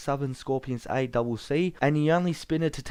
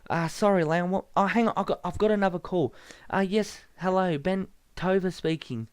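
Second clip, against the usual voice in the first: higher, polite hotline voice; a nervous clerk handling a caller; Ben Tover's voice; 5 semitones higher than usual. Acted. Ben answering a membership hotline caller, higher and polite. Ben takes a prank call.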